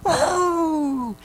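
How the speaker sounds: high, loud, exultant whoops; heavenly cheering. Retold or imitated. Recalling the cloud of witnesses cheering during worship, high joyful whoops. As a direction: cry out in joy like a cheering crowd. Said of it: "I heard".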